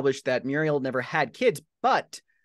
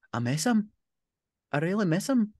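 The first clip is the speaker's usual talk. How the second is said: put-on higher, small teenage voice; grieving teen; 10 semitones higher than usual. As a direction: nephew telling his aunt he misses his dad.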